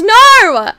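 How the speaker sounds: high, loud drawn-out NO; shouted refusal. Pretend. Streamer reacting to a chat question, shouting in protest. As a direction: yell it in mock horror.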